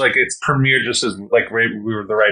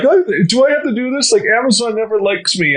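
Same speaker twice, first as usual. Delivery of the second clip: put-on higher, fussy old grandma voice; 9 semitones higher than usual. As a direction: play the worried grandma, pitched up.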